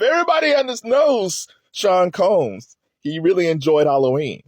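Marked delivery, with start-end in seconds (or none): none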